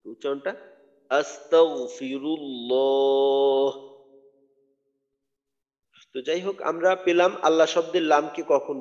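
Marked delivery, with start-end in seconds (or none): 1.1-3.7 আস্তাগফিরুল্লাহ chanted very slowly with a thick, full গ; slow recited আস্তাগফিরুল্লাহ with a heavy unbounced গ. Shown as a demonstration. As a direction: draw out আস্তাগফিরুল্লাহ, sounding গ heavy and once only. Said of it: "গইনের আওয়াজটা মোটা করব".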